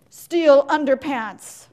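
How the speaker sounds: a bombastic slide title read to the crowd; dramatic announcement. Acted. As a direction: boom it out like a grand reveal. Dramatic reading of the gnome slide.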